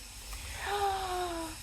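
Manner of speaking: sharp surprised gasp of recognition; retold gasp. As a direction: gasp like you just spotted an old friend. Reunion in Moscow meeting.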